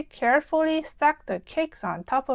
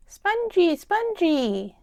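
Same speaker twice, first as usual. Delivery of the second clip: little George; 4 semitones higher, slower than usual. Acted. Little brother George repeats word.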